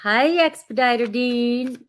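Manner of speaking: slow, stretched Southern greeting; playful Southern twang on a name; southern drawl. Pretend. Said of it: "I give you such a southern, a southern draw".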